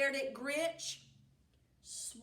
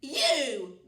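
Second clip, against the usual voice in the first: loud call; 3 semitones higher, 9 dB louder than usual. Acted. Witch calls the cow.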